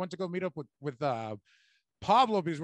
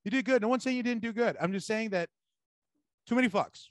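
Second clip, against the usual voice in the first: higher, coaxing reassurance; mock reassuring mentor; 5 semitones higher than usual. The headliner calming the nervous opener, higher and coaxing.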